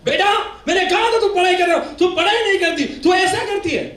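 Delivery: high, loud shouted scolding; mother yelling at a failed daughter. Retold or imitated. Mother scolds daughter over her failed result, high angry shout. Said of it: "माँ ने बहुत डांटा उसको".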